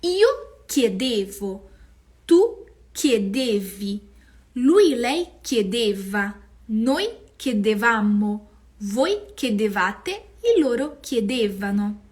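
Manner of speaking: slow, loud drill of each form; slow model conjugation. Shown as a demonstration. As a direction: recite the conjugation for students. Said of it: "Por exemplo".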